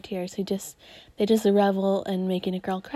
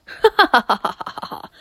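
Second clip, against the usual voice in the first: hammed-up evil laugh; evil laughter; 6 dB louder, faster than usual. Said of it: "Evil laughter insert here".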